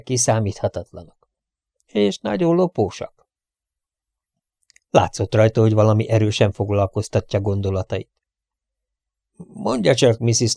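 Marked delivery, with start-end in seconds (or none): none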